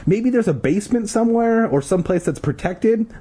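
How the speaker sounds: restrained shout over wind; mock shout. A narrator's reading. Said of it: "shouting over the roar".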